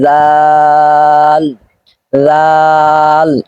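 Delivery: লাল said thin and light, not heavy; light letter. Shown as a demonstration. Teacher showing a light letter to students. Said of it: "এটা পাতলা করে হবে".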